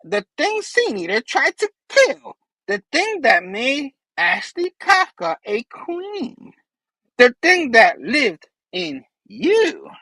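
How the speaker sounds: high, slow, ominous villain; Green Goblin voice. Retold or imitated. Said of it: "Goblin's like".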